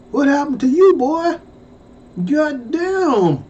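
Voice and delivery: shocked exclamation. Retold or imitated. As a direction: voice jumps up in disbelief. Shocked at classmate's looks.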